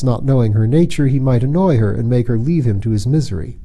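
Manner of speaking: ordinary delivery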